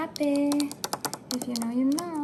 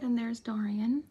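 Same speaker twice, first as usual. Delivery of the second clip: ordinary talk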